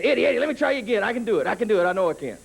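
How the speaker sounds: put-on high, fast eager kid; pestering little brother. Brother imitating the kid pestering for a rematch, high and fast. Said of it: "Then he'd come back again".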